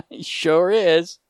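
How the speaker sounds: slow words through laughter; laughing. Real, not acted. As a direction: laugh while saying it. Laughing at the master joke.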